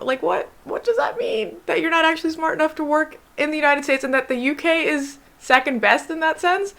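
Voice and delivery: high-pitched, baffled questioning; incredulous. Real, not acted. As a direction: question it in rising disbelief. Reacting incredulously to an insult.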